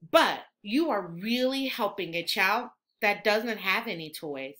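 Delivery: ordinary delivery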